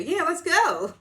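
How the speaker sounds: high, loud, put-on eagerness; hammed-up chirpy agreement; sudden fake cheer. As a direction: flip into a bright-eyed, high-pitched yes. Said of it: "evil face, went back to".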